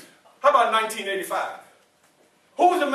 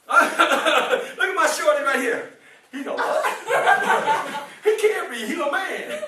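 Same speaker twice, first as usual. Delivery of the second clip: slow, laughing delight; 4 semitones higher, slower than usual. Real, not acted. Speaker laughing at a boy in the audience, laughing. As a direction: chuckle through the teasing words. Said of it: "laughing at the boy's reaction".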